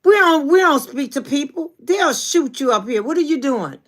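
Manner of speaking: high, loud retold warning; alarmed friend's scolding. Imitating Long Beach friend scolding the waving visitor, high and loud. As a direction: mimic a friend's high-pitched, urgent telling-off. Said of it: "that girl said".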